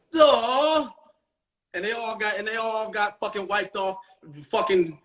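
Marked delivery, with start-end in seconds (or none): 0.1-0.9 high, loud mock "Duh"; dumb rivals. Losing sperm cells answering, a high dumb mock "Duh". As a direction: play the losing sperm as dim. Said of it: "And they're going".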